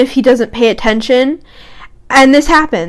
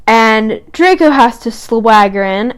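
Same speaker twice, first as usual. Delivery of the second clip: ordinary talk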